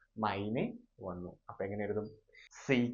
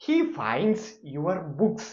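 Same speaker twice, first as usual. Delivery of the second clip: very slow, higher and louder; slow emphatic example; 6 semitones higher, 8 dB louder, much slower than usual. Shown as a demonstration. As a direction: announce the final example. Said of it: "അവസാനത്തെ example".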